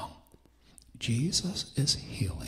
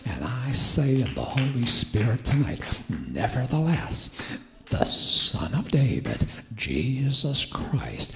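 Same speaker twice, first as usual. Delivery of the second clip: full-force preaching; 4 dB louder than usual. Real, not acted. Preacher declaring healing to the church, bold and forceful. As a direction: proclaim it boldly, stretching key words.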